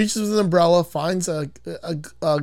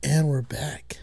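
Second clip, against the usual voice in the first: low, soft creepy sign-in; a hint of predatory menace; 3 semitones lower, 7 dB quieter, much slower than usual. Shown as a demonstration. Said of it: "it sounds so creepy when I say it".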